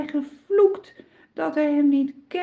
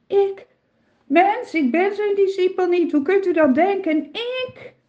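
high, anxious protest, speeding up; panicky, insisting too hard; frightened denial; 7 semitones higher than usual. A narrator's reading. Peter denying he is a disciple, high and frightened. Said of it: "Het is zijn angst waardoor hij zo spreekt".

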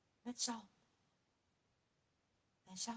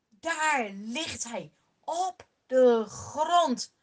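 louder and higher, then slow and heavy at the fall; building excitement; 5 semitones higher, 8 dB louder, slower than usual. A narrator's reading. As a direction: build it up, then let the giant crash down slowly. Climax: steen treft Goliath. (opbouwende spanning, luid en hoog, dan langzaam)

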